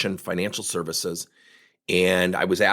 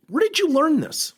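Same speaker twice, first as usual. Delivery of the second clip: high, amazed question; 3 dB louder than usual. Retold or imitated. Asking the salesman, amazed and high. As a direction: ask it in wide-eyed wonder.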